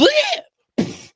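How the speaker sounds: high, loud choking squawk; a bird keeling over with a squawk; high strangled bleh; parrot collapse squawk. Acted. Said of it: "another parrot went".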